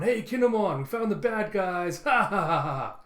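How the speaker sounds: high, loud boast ending in laughs; acted gleeful holler with big ha-has; Luffy calling out. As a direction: shout the good news, then laugh. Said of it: "As you have Luffy going".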